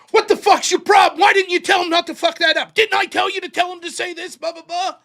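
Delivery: loud, high yell at his debater; coach shouting in anger. Retold or imitated. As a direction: blow up at him like the coach did. Coach yelling at the speaker.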